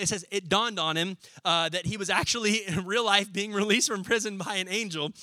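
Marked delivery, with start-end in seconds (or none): none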